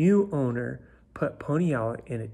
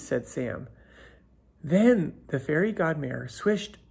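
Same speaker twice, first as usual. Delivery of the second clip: ordinary delivery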